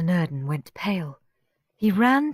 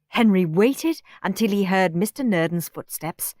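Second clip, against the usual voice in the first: ordinary delivery, nothing marked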